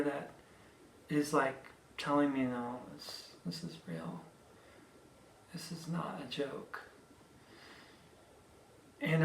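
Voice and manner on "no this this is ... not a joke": faint breathy whisper, barely voiced; whispered realization. Injured driver whispers to herself seeing her cut. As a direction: whisper it as the truth sinks in.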